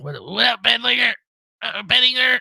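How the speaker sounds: high-pitched mock greeting; trying out the name. Real, not acted. Streamer unsure how to say a name, high silly attempt. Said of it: "I don't know how to say it right".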